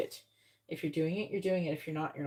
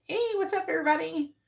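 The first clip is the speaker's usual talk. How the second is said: mock cheery greeting; 8 semitones higher than usual. Mock greeting while mingling.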